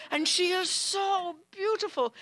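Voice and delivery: high, dreamy and adoring; smitten guest. Retold or imitated. Guest in awe.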